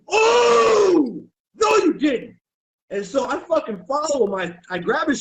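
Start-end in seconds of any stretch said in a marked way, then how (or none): 0.1-2.4 high, loud, indignant oh no you didn't; shrill-free hammed-up sass; sassy outraged shout. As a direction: call him out in mock outrage. Outraged retort in retold confrontation.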